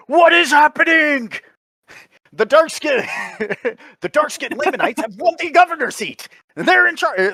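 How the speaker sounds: mock yell. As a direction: shout it like a bad dub. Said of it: "I just wanna change it to".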